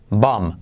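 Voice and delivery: "Bomb" dicho como "bom", sin b final; "Bomb": termina en m, la b muda; sin pronunciar la b. Shown as a demonstration. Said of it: "repite conmigo". ("Bomb" pronunciado bom, b muda)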